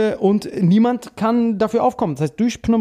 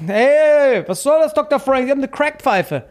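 mock confrontation; 8 semitones higher, 3 dB louder than usual. Voicing someone confronting the doctor.

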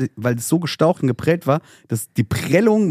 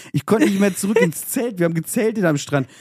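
normal talking voice

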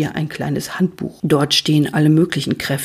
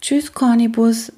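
raised voice, understated sadness; sad goodbye; 7 semitones higher, 3 dB louder than usual. A narrator's reading. Luzifer leaves without seeing Cornibus, mildly sad farewell. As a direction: bid farewell with a touch of sadness. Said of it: "sage ich noch traurig".

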